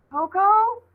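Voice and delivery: worried call into the corn; calling again. Acted. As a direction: call out, hoping for an answer. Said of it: "Ollie called again".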